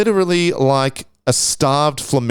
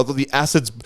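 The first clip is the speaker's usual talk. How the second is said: usual speaking voice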